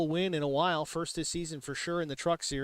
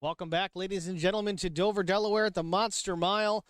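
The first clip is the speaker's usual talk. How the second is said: normal talking voice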